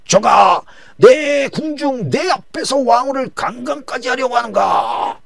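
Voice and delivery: high, slow, loud outraged roar; king enraged finding Haman at the queen's couch; outraged king's shout. Acted. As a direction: thunder the accusation.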